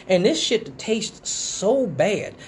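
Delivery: normal talking voice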